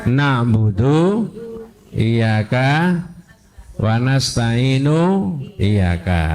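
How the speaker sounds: each word laid out slowly, spaced apart; slow reordered demo. Shown as a demonstration. Teacher slowly showing the student the wrong word order. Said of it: "Kalau iyyaka di belakang, bacanya gimana?".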